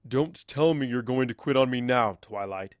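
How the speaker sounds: lightly voiced old mentor, chiding; a gentle scolding from a mentor. Starswirl chiding Twilight for giving up, mildly scolding. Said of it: "he chides".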